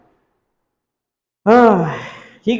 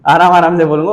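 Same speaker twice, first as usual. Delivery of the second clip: quick and louder, laughing it off; laughing, back to normal; 7 dB louder, faster than usual. Shown as a demonstration. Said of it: "वो slow motion वाला button दबा दो".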